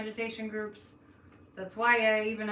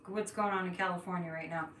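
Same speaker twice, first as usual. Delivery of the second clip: ordinary talk, nothing marked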